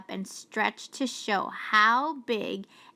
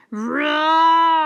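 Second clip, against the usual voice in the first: loud high lion roar; mighty roar; 7 semitones higher, 10 dB louder than usual. Acted. Trapped lion giving a mighty roar, loud. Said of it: "He gave a mighty roar".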